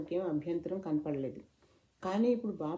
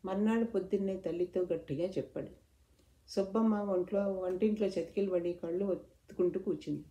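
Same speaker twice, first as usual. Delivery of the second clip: plain reading voice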